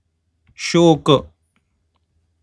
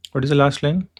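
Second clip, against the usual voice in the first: normal talking voice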